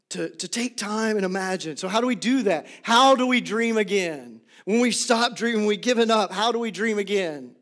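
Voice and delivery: normal talking voice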